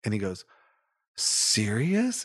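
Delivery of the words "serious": high, rising, amazed question; jaw-dropped disbelief. Retold or imitated. Boy to lady giving him her hat, imitated amazement. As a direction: imitate a kid stunned by a gift. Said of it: "just jaw dropped".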